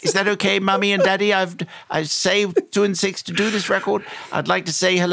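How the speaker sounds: put-on very proper small child, pitched right up; posh tiny child. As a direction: imitate your prim childhood self on an old recording. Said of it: "tiny child speaking as though received pronunciation".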